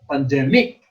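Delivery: "pandemic" as pan-dem-IC; wrong stress demo. Shown as a demonstration. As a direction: misplace the stress onto the last syllable of "pandemic". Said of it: "We don't say".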